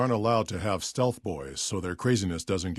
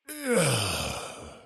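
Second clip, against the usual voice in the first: soft pained groan; dying groan; 5 dB quieter than usual. Acted. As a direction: groan as the strength goes.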